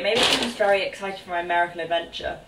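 usual speaking voice

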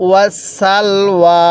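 long drawn-out chanted recitation, every vowel stretched; slow Quran recitation. Shown as a demonstration. Teacher models the verse for students, slow drawn chant. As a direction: intone it slowly, as the teacher's model reading. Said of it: "কিভাবে পড়ব?".

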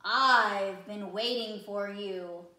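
slow, loud, booming octopus; a wise old octopus, slow and heavy; deep octopus voice. Acted. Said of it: "said the octopus with a deep voice".

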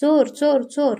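narrator's restrained shout; startled shout. A narrator's reading. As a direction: call out as if startled awake, without full force. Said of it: "जोरात ओरडली".

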